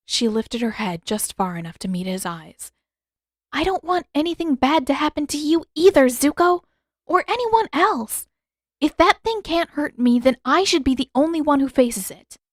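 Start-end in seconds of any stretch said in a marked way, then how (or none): 3.5-12.4 put-on higher, louder young woman pleading her case; young heroine voice, heated; Katara, insistent. A narrator's reading. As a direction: argue urgently with Zuko.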